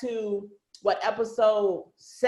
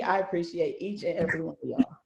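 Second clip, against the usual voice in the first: normal talking voice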